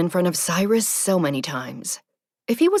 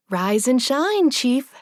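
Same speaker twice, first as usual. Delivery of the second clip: cheerful wake-up call, higher and louder; smiling; 8 semitones higher, 4 dB louder, slower than usual. A narrator's reading. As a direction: smile through a sunny morning greeting.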